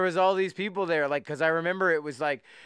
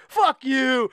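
loud, higher, defiant retort; fuck-off attitude; 6 semitones higher, 5 dB louder than usual. Retold or imitated. Drinker to friend urging a slowdown, fuck-off attitude. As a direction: throw it back defiantly, retelling a drunken outburst. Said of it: "I had this fuck off attitude".

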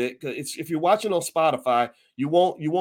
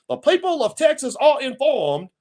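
high, loud put-on Southern accent; 8 semitones higher, 5 dB louder than usual. Host mimics General Gordon reading the order, Southern accent. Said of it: "I'm gonna use my Southern accent".

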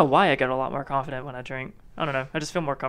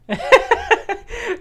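high burst of laughter; big laugh. Real, not acted. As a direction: crack up at the story. Friend laughing at drunk story.

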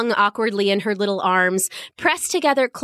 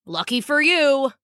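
guard voice; 3 semitones higher than usual. Acted. Guard speaks.

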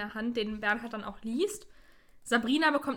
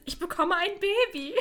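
higher excited announcement; excited cry; 6 semitones higher than usual. Retold or imitated. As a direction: burst out with the news. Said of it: "Bibi ruft ganz aus dem Häuschen". (Bibi ruft ganz aus dem Häuschen)

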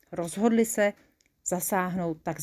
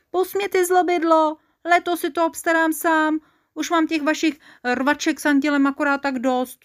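high, loud protest; fed-up, high-voiced outburst; indignant protest; 8 semitones higher, 4 dB louder than usual. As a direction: shout them off, pitched well up. Mikuláš fends off the quarrelling helper.

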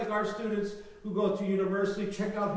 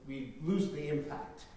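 normal talking voice

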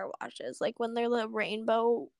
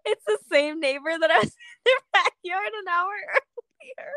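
words broken up by real laughter; crying with laughter; 4 semitones higher than usual. Real, not acted. Friend telling cohost about egging the neighbor, crying with laughter. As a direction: laugh helplessly through the words. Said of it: "I'm literally crying".

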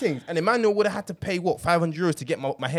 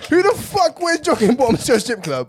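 louder, higher, indignant rant; mock outrage; 7 semitones higher, 6 dB louder than usual. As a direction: rant like it still offends you. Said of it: "how angry I was".

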